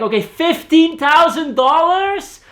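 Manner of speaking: high, loud and slow, stress on thousand; shouted question. Retold or imitated. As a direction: yell back a misheard price as a question. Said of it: "the deaf brother would scream back".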